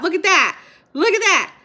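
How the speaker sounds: thrilled exclamation at a chat comment; excited. Real, not acted.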